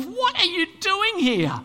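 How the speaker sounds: high, loud panicked outcry; acted fearful shout, stretched and high; scared evil spirit. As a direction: cry out in alarm at an unwelcome arrival. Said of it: "all the scared spiritual evil beings".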